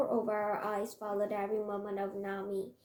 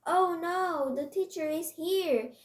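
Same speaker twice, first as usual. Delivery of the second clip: high, loud cry of alarm; kids' raised-voice alarm call; alarmed shout; 7 semitones higher, 7 dB louder than usual. A narrator's reading. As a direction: shout a warning to the whole class. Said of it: "the kids shouted".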